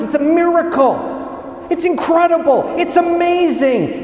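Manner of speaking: very high, stretched-out awe; acted amazement. Crowd marvelling at the miracle of the feeding, high gushing amazement. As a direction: gush like an astonished crowd.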